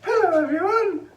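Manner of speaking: high, loud mock phone greeting. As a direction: imitate her posh phone hello. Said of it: "It's my phone voice".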